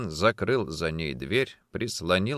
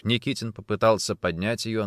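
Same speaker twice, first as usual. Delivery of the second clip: ordinary delivery, nothing marked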